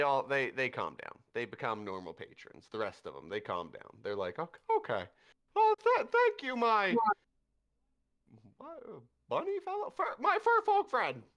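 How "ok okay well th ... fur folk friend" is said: very high, loud, flustered thanks; high squeaky thanks. Acted. Calmed patrons thanking the bunny folk, high squeaky voice. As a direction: squeak out nervous gratitude.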